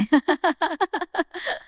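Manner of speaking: high, staccato laughter; a high run of ha-ha giggles. Acted. Laughing during talk over a late dinner, high giggle.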